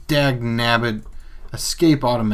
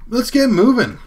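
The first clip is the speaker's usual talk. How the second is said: excited; 12 semitones higher, 5 dB louder than usual. Real, not acted. Game start cheer.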